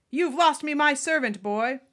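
high, very loud outburst of rage; furious shout. Acted. Malfoy lunges at Harry for freeing his servant, furious shout. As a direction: roar it at the boy.

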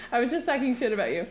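ordinary delivery

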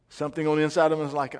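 ordinary delivery

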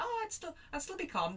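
high-pitched, mock-confident claim; airy self-assurance. Imagining herself coping in a crisis, airily self-assured. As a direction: play the naive optimist, voice lifted high. Acting out her naive assumption.

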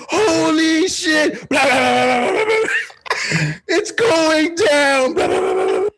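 high, loud mock panic, half crying; hammed-up frantic yelling like under gunfire; frantic panicked shout. Friend fakes a frantic emergency call.

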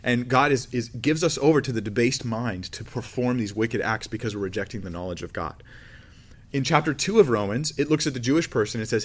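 ordinary talk

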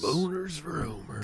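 silly high voice. Acted. Friend repeats in silly voice.

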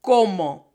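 "Como" with closed pure O, no glide; correct pronunciation. Shown as a demonstration. Teacher models right Spanish vowel for class. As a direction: say "Como" crisply with short closed Spanish O sounds. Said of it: "You hear the difference?".

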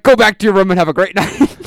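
loud, hearty send-off; hammed-up cop dismissing a kid; exaggerated cop. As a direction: proclaim it like a bombastic cop, breaking into laughter. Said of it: "Are you exaggerating the way that he talked".

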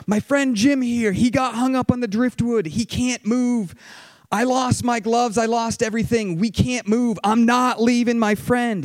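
desperate pleading shout, as retold; retold panicked screaming. Stranded musher screaming back about his trapped friend, retold yell. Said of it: "he's screaming back at Phil".

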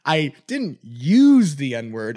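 very slow, deliberate imitation; slow mock quote. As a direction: drag it out like him. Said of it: "he would say".

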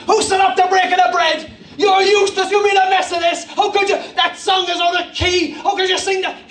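high, hammed-up scolding; mock outraged heckling; angry church quarrel. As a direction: rant like a furious congregant, pitched high. Mimics abusive church meeting.

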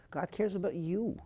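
"God cares about you" lifted high with a rising end; fervent plea. Retold or imitated. Physician pleads with suicidal girl.

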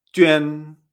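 YUAN said "jü-en", loud and held level; pronunciation with J. Shown as a demonstration. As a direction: open YUAN with a j sound, then ü-en. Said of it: "con J".

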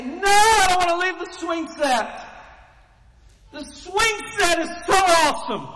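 put-on high, loud whining child pleading to stay; whiny kid begging. Preacher voicing a child refusing to leave the swing set, loud high whine. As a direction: play the kid who won't leave the swing.

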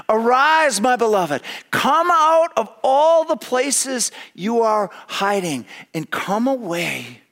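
high, loud, stretched-out summons; ringing call. Shown as a demonstration. God calling his beloved to come out, loud and ringing. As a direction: call them out of hiding. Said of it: "he says it twice".

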